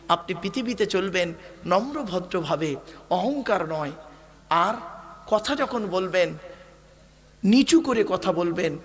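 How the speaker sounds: normal talking voice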